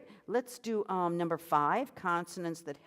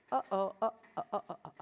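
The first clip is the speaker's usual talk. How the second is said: gentle glottal clicks; 3 semitones higher, much faster than usual. Shown as a demonstration. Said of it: "gentle chord clicks".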